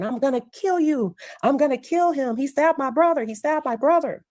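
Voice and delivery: high-pitched, urgent mock outcry; retold distraught threat. Kid running at the boy who stabbed his brother, retold distraught threat. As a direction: imitate a kid crying out for revenge.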